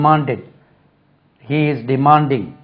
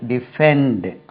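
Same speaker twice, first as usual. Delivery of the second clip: Defend with the second syllable stressed and drawn out; slower than usual. Shown as a demonstration. Teacher showing learners where the stress lies, second-syllable stress on defend. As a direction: lean on the FEND of defend. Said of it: "Stress lies on the second syllable".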